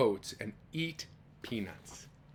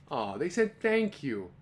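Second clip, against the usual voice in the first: plain reading voice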